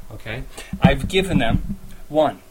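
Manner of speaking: normal talking voice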